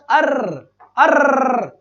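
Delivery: "अर्र" with a long rolling R; drawn-out buzzing "अर्र", like a rattle; trilled R sound. Shown as a demonstration. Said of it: "अर्र की sound कहाँ आती है".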